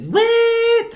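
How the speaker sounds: squealed high-pitched wit; squeaky high wit. Pretend. Mocking the word wit.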